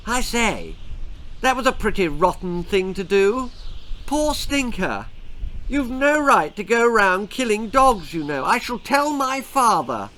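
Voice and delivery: put-on a touch of a proper boy's outrage; indignant schoolboy. A narrator's reading. Schoolboy scolds the farmer after the dog is shot, mild indignation. Posh boy protests to farmer.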